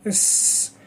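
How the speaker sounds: "S" as a bare hissed consonant, no voice; sustained S sound. Shown as a demonstration. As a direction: hold a long hissing "S". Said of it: "behind your teeth".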